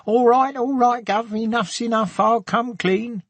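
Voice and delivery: high-pitched mock Cockney crook. A narrator's reading. Man jokingly surrenders to policewoman as if for handcuffs, Cockney. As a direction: put on a high Cockney villain giving himself up. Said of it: "said in a Cockney voice".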